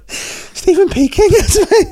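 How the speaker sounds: high, slow loud robot voice; drawn-out speech-synth voice. Pretend. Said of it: "the voice is exactly the same".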